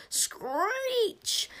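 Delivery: piercing high screech. A narrator's reading. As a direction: shriek it high and long. Sound effect in story.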